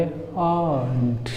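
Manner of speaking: आंट as one drawn-out "aant"; slow corrected आंट in one syllable; silent r, long vowel. Shown as a demonstration. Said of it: "वो silent रहता है".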